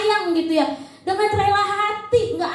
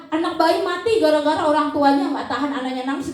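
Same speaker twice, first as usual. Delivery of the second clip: ordinary delivery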